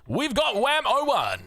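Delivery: high, drawn-out announcer call; big, slow player intro; player introduction. Real, not acted.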